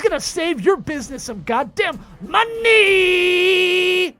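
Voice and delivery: high, loud, drawn-out hype call; announcer roar building to a yell; hype shout. Acted. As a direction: whip the crowd up for the guest.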